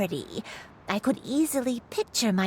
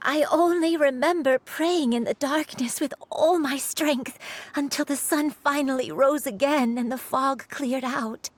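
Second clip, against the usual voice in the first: ordinary talk, nothing marked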